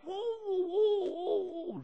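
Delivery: high, hammed-up crowd shout; crowd yelling. Preacher imitating the crowd yelling at Jesus, high mock shout. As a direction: cry out like the jeering crowd. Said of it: "orang teriak-teriak".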